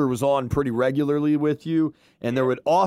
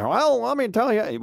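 higher, put-on old-school radio announcer; 8 semitones higher than usual. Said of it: "make fun of his radio voice".

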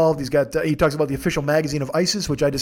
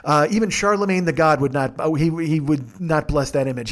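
normal talking voice

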